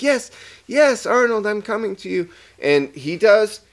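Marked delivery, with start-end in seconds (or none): 0.0-2.3 high, eager answering voice; eager pet reply. Retold or imitated. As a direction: answer like a dog running to its owner. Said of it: "responding as such".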